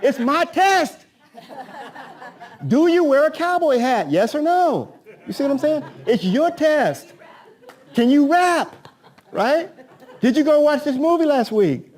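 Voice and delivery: high, loud joking quiz voice; hammed-up excitement. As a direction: sell the rigged quiz like a carnival barker. Joking about a rigged quiz.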